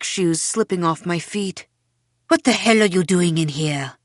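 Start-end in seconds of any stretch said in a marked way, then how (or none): 2.3-4.0 loud, sharp demand; angry. A narrator's reading. Mother confronting Belle in her closet, angry. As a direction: scold hard, caught-you fury. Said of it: "eyes flashing with anger".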